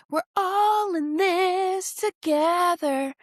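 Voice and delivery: high, slow, sing-song "[name]"; High School Musical quote. Retold or imitated. Quoting the movie to women who judge her, slow and high. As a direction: stretch it out sweetly, like the movie. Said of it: "High School Musical".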